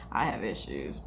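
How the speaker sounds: muttered admission. Real, not acted. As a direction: mutter it to yourself.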